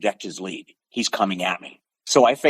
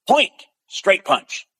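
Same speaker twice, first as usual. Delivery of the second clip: referee call; 8 semitones higher, much slower than usual. Retold or imitated. Referee calling the point.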